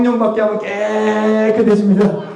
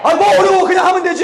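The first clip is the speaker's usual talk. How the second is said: high, loud, what's so hard; exasperated exclaim; 8 semitones higher, 5 dB louder, faster than usual. Real, not acted. Preacher exclaiming loudly. (높고 크게 답답한 듯 외치며)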